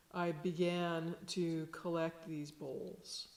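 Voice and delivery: usual speaking voice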